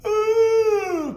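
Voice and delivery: long, loud, very high "ooh"; hyper mock squeal. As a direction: let out an exaggerated hyper whoop. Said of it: "'cause I act hyper".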